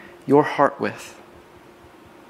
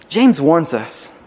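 ordinary talk

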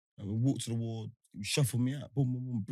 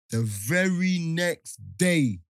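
very slow, louder setup line; slow dramatic build-up; 4 semitones higher, 5 dB louder, much slower than usual. Real, not acted. Building suspense for friend before the punchline, slow. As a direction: stretch each word for suspense.

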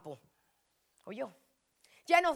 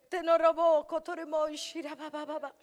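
speaking in tongues; 3 semitones higher, 5 dB louder than usual. Real, not acted. Preacher to the congregation, tongues in prayer. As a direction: burst of prayer syllables.

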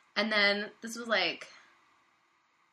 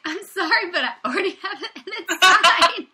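high, loud gloating mock apology; smug; 10 semitones higher, 10 dB louder than usual. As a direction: gloat with fake sympathy. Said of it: "I'm sorry for your loss ... self-satisfied".

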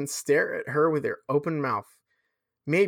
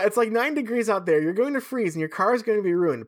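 it's like nine degrees out there, higher and louder, pleading; desperate plea; 7 semitones higher, 3 dB louder than usual. Shown as a demonstration. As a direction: beg her urgently, voice pitched up. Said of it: "desperate to not leave such a horrible impression".

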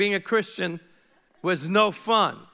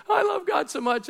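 much higher, mocking a gushing believer; 13 semitones higher than usual. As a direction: send up the gushy churchgoer. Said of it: "I love to watch people say".

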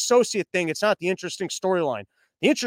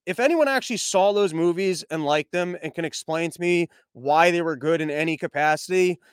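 normal talking voice

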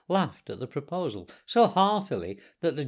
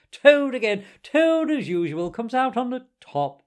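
high, loud, gloating Toad; ecstatic self-admiration; 8 semitones higher, 5 dB louder than usual. A narrator's reading. Toad praising himself after his escape, ecstatically boastful. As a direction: crow over each triumph, pitched well up. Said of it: "in ecstasies of self-admiration".

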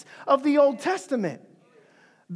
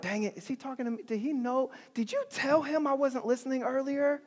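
much softer, fast, nervous under the breath; embarrassed Peter; 9 dB quieter, faster than usual. Retold or imitated. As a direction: rush it quietly like a caught kid. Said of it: "Peter's gotta be like".

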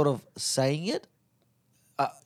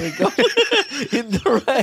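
laughing; 8 semitones higher, 5 dB louder than usual. Real, not acted. Both cracking up at the memory.

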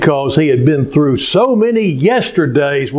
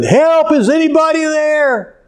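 acted yell for help; 13 semitones higher than usual. Man hanging from a branch calls for anyone above, high acted yell. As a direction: call out into empty space, pitched high. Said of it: "And he kept yelling".

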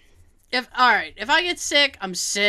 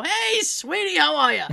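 higher, sing-song imitation of a friendly doctor; chummy doctor greeting; 6 semitones higher than usual. Doctor greeting his favourite patient, imitated with a chummy lilt. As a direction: play the doctor breezing in all pally.

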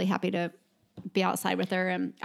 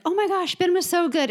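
high, bright mock gush, a little louder; imitating gushing parents; 10 semitones higher, 4 dB louder than usual. Other parents praising Ben to his mom, in a high mock gush. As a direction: squeal it like a fan of the show. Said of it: "everyone was coming up to me and like".

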